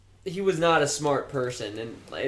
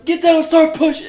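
barked command, much higher and louder; yelled order; 12 dB louder than usual. Retold or imitated. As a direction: yell it at the recruit like a drill sergeant. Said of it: "he just yells at him".

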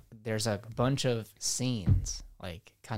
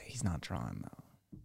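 hushed whisper aside; 8 semitones lower, 8 dB quieter, much slower than usual. Real, not acted. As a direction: drop to a breathy whisper. Correcting friend in hushed aside.

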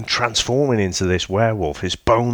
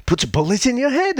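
bright, much higher chirpy voice; cheerful ghost, pitched way up; chirpy undead girl; faster than usual. Retold or imitated. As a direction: chirp it like nothing's wrong. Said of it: "she's very, very, uh, chirpy".

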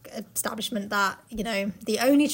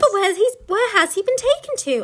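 higher, louder anxious question; worried girl; 8 semitones higher, 5 dB louder than usual. Acted. Lucy asking the beavers about Tumnus, worried and higher. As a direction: plead like a worried little girl. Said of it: "asked Lucy".

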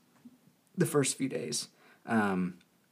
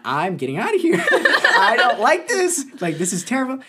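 higher and louder, laughing while protesting; 13 semitones higher, 8 dB louder than usual. Retold or imitated. Retelling refusing hospital care, laughing protest. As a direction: whine and laugh at once. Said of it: "I'm like".

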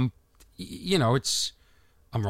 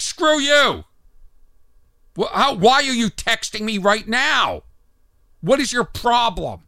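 higher-pitched indignant rant; mock angry outburst; 5 semitones higher than usual. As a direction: act furious at an innocent texter. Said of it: "You're angry at this per".

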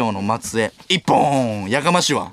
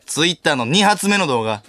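ordinary delivery

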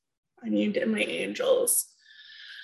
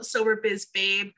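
ordinary talk

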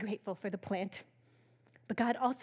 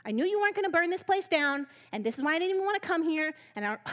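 yelling at God; 3 semitones higher, faster than usual. Retold or imitated. Jonah yelling at God, mock furious. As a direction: blurt out the grievance at God. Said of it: "He's yelling at God".

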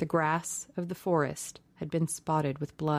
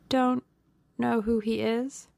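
incredulous echo; 6 semitones higher than usual. A narrator's reading. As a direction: throw the words back in disbelief. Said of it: "said Dorian listlessly".

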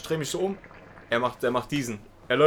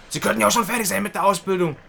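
high, raised mock reproach; reproachful clerk; 6 semitones higher, 5 dB louder than usual. Clerk reproaching the older trainee, high scolding imitation.

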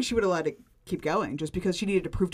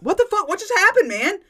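high, loud what-the-hell disbelief; loud stunned disbelief at a sudden threat; incredulous outburst; 11 semitones higher, 9 dB louder than usual. Real, not acted. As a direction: blurt it out in shocked disbelief. Outraged at Riley's threat.

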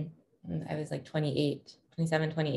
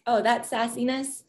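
higher-pitched "oh" of recognition; imitated inner realization; 8 semitones higher than usual. Parent seeing traits mirrored in the child, higher-pitched imitated realization. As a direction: voice your own thought, pitched well up. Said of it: "mirroring back, like".

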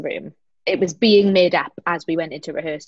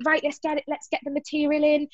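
lightly acted, higher-pitched resolve; briskly rally the room, only half in earnest; mock getting down to work; 7 semitones higher, 3 dB quieter than usual. Acting out the rehearsal's next stage.

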